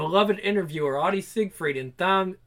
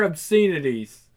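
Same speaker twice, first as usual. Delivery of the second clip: ordinary delivery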